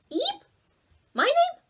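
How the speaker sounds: very high, tiny squeak; tiny bug squeak. Acted. The bug asking Oliver's name, high and tiny.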